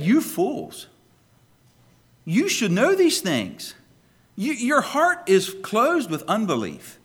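stern rebuke. Acted. Jesus calls the disciples out.

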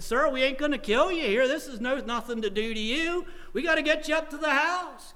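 high, loud, firm retold orders; frank insistence. Preacher retelling how he reasoned with a drunk, frank and urgent. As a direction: talk firmly over a hollering drunk. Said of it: "I started getting kind of frank with him".

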